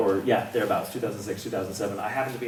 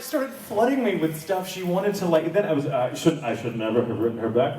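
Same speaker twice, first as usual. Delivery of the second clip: ordinary talk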